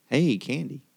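ordinary talk

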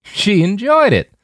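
jeering bark of a laugh; harsh barking laugh. Acted. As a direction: spit it out with a cruel laugh. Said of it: "with a harsh bark of a laugh".

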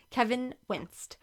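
plain reading voice

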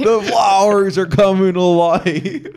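laughing. Pretend. Mock spooky living flowers.

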